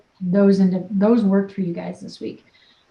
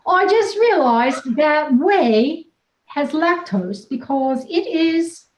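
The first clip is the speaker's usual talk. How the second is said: slow, higher mock of Faith's accent; a slow, high try at Faith's accent; imitating Faith's accent; 5 semitones higher, slower than usual. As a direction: put on Faith's accent, slowly and carefully. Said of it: "I'm getting better at my accent".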